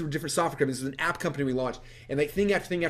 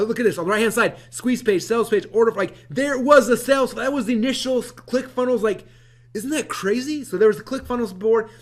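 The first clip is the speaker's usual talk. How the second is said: higher and louder, marvelling; excited; 7 semitones higher, 6 dB louder than usual. Real, not acted. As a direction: show it off like a found treasure.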